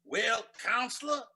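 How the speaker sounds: loud, slow, drawn-out mock-formal indignation; nasty courtroom sparring. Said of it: "the judge and the lawyer were getting nasty".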